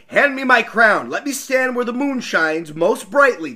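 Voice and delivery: a hint of a regal king; loud, commanding royal voice; Elf King. A narrator's reading. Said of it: "the Elf King said".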